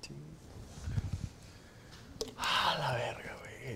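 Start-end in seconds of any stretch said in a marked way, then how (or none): 2.4-3.8 breathy whisper, voice almost gone; hushed "ah, la verga"; whispered dismay. Retold or imitated. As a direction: whisper it in defeat. Reacting to failing the exam.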